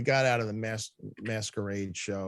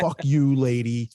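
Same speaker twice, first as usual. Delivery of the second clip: mock curse; 3 semitones higher, 7 dB louder, slower than usual. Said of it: "I was like".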